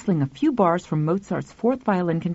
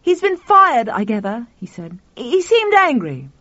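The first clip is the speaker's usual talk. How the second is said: agitated; 4 semitones higher, 3 dB louder than usual. Acted. Tuffy agitated.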